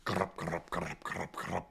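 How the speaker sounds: low, soft clopping mouth noises; rhythmic galloping clip-clop sound; imitated hoofbeats. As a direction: make horses' hooves with your mouth. Imitates hooves of galloping horses.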